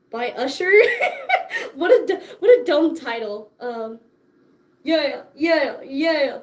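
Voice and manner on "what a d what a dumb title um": normal talking voice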